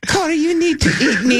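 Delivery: tempting the host in a silly high voice; Italian French fry. Retold or imitated. Host retells his cohost's fry character tempting him, high mock voice. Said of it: "Chris was the Italian French fry".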